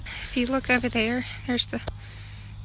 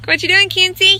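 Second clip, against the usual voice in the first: calling out; 6 semitones higher, 12 dB louder than usual. Real, not acted. Calling to daughter across patch.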